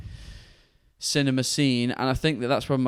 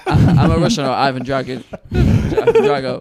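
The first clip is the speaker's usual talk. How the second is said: mock Russian accent; 8 dB louder than usual. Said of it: "That sounds Russian".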